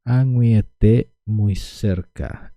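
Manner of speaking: añuiete stretched out very slowly; very slow drill. Shown as a demonstration. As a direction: linger on añuiete so it sinks in. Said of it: "tendríamos añuiete".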